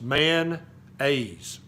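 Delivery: man-aise said in two beats, no O; Southern man-aise; two-syllable man-aise. Shown as a demonstration. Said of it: "It's two syllables".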